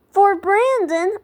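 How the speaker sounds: high, drawn-out startled question; flustered surprise. Acted. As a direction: squeak it, caught off guard. Flustered reply about her crush.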